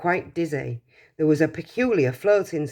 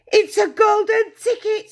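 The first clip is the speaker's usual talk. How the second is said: shrieked much higher and louder; screaming; 6 dB louder than usual. Acted. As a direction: scream it out in wild excitement. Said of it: "screamed the shopkeeper".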